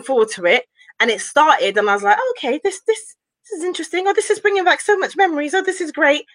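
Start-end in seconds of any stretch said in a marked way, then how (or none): 2.2-6.2 higher, quick, pleased reaction; retold delight. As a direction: act out your first eager impression. Acts out her early excitement.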